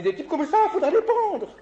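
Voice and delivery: high, loud, outraged cry; indignant outburst. Retold or imitated. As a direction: throw it out like a scandalized pastor. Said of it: "Il était tout énervé".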